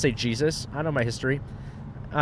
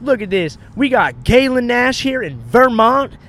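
high, loud, drawn-out hype; mock an overexcited promo host; imitated hype-video host; 8 semitones higher, 6 dB louder, slower than usual. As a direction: shout out to the camera, stretching the words. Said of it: "trying to make a hype video".

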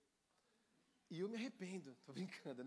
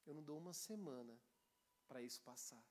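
much softer and lower, unhurried; calm and tranquil; 7 semitones lower, 10 dB quieter than usual. Retold or imitated. Pastor calmly answering an overexcited young man. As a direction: settle him down gently. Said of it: "com muita tranquilidade".

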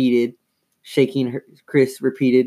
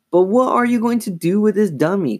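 high, pressing question; demanding; 10 semitones higher, 3 dB louder than usual. A narrator's reading. Chris questions Lindsay about plans, high and demanding. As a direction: press for an answer, pitch raised. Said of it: "Chris demanded".

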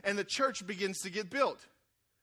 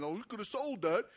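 complaining disciples. Retold or imitated. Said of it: "the disciples start complaining".

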